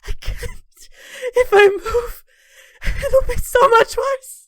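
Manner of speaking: high, strained voice cracking with pain; tortured prisoner begging through pain; pained crying whimper. Acted. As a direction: squeeze each word out through agony. Tortured Fenris strapped to table.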